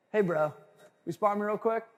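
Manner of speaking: ordinary talk, nothing marked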